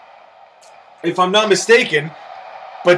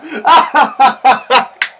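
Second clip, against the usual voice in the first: real helpless laugh; burst of laughter. Real, not acted. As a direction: let the laugh roll out. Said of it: "Oh, that was funny".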